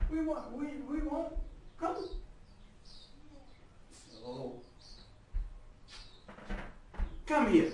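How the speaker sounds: soft and high, coaxing a pet; coaxing a dog. Real, not acted. Guru softly coaxes his dog Nele onto the table. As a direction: gently coax the dog to come and stay.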